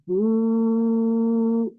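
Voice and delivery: बू drawn out long; long held u. Shown as a demonstration. Teacher holds बू long for the students. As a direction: sustain बू for two seconds. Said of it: "इतना खींचूंगी".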